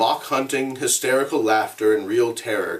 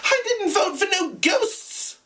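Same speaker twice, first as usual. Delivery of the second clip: much higher, shrilling protest. Acted. Piggy protesting to the assembly, high and shrill. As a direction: let the voice shrill up in panic. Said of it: "Piggy's voice shrilled".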